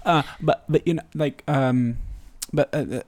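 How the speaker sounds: halting, stammering false starts; imitating a flustered, stumbling reply; fumbling for words. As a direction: search for the right words and never find them. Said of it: "fumbling to find the right words".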